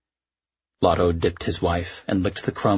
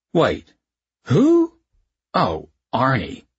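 snide, jealous little jab; spiteful; 6 semitones higher, 4 dB louder, slower than usual. A narrator's reading. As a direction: drip with spite over the name.

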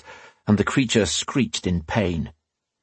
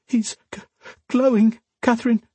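put-on high, halting little-girl voice; tearful sniffling; 13 semitones higher than usual. A narrator's reading. Crying girl to Catherine who hugs her, sniffling. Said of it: "the girl sniffed".

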